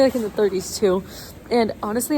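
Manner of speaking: ordinary delivery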